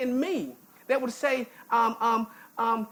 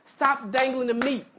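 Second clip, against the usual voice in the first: usual speaking voice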